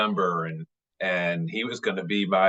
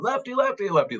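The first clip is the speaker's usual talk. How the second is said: retold yelling. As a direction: imitate dad calling out to a player, pitch way up then dropping. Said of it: "he kept yelling".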